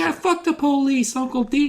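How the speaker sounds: put-on high cheeky kid cheering. Nephew egging on Uncle D in a high kid voice. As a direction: squeak it out like a bratty nephew.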